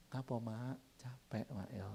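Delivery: very soft, soothing husband; gently coax, barely above a murmur; tender concern. Acted. Husband practising on his nagging wife, softly tender.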